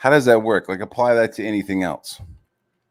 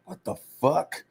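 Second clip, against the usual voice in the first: higher, baffled what the fuck; stunned disbelief; 7 semitones higher, 4 dB quieter than usual. Retold or imitated. Streamer recalls seeing a rival's stream, in high disbelief. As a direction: react like you can't believe what you're seeing. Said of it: "yeah, dude, I was like".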